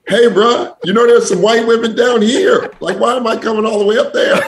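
high, laughing hammed-up salesman; lightly voiced pushy recruiter; mock recruiter pitch. Mocking the coach's pitch to the host, high and laughing.

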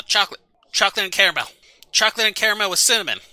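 rapid-fire, high, excited guessing. Acted. Cha guesses drink flavors at a party game, very excited. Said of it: "very excited by this".

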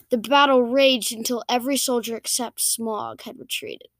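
usual speaking voice

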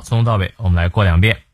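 usual speaking voice

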